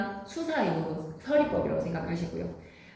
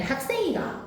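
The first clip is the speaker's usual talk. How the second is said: high Gyeongsang lilt on 학생이가, the final 가 pitched up; teacher demos a Gyeongsang question, sing it up high; Gyeongsang dialect; 11 semitones higher than usual. Shown as a demonstration. 교사가 학생들에게 경상도 사투리 의문문을 시범: 높은 억양. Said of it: "경상도 사투리는 다 판정 의문문이 아로 끝나요".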